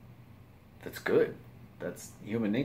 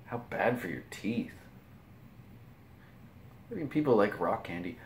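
ordinary talk, nothing marked

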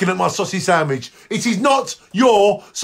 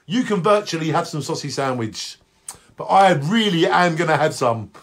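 usual speaking voice

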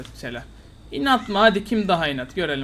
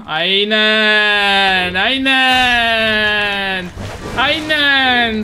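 mocking drawn-out taunt; 3 semitones higher, 7 dB louder, much slower than usual. Real, not acted. Taunting the enemy player.

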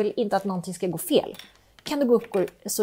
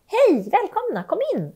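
bright welcome; 9 semitones higher, 3 dB louder than usual. Acted. Greeting arriving guests.

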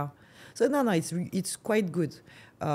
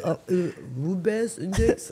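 mocking the listener; 3 semitones higher, 4 dB louder than usual. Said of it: "you're like".

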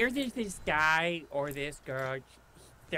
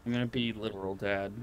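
deep dad voice; 11 semitones lower, 6 dB quieter, faster than usual. Pretend. Announcing a dad voice.

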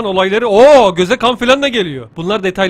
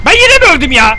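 loud, high cry of disbelief; exasperated yell; 7 semitones higher, 8 dB louder than usual. Real, not acted. Gamer finding he has died once more, exasperated yell. Player died again in game.

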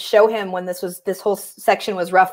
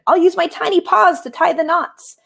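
high little mouse voice; volunteer in a high, lilting voice; 9 semitones higher than usual. Acted. Mouse offering to tie knots, high little voice. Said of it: "Mouse is saying".

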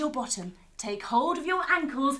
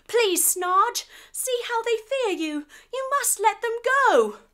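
higher, louder earnest plea; brave pleading; 10 semitones higher, 5 dB louder than usual. Acted. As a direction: stand up to the bully and beg for friends. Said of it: "He stands up tall and brave".

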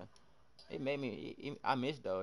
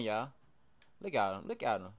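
usual speaking voice

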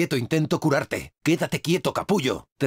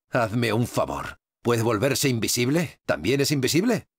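weary disbelief; 7 semitones lower than usual. Acted. Disbelief at enemy's tricks.

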